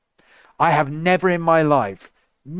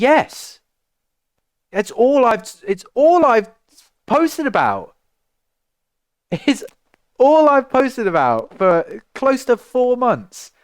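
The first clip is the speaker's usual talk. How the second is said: exasperated; 9 semitones higher, 4 dB louder than usual. Real, not acted. Exasperated answer to fans.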